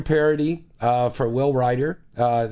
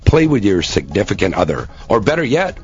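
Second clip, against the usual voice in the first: loud, punchy ad pitch; hard sell ad; 6 dB louder than usual. Acted. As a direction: sell it hard like a radio spot. Hard-sell radio ad read.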